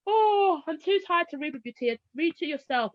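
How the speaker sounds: big exasperated groan easing into tired excuses; weary parent. Retold or imitated. Worn-out mother brushing off her child at evening, weary.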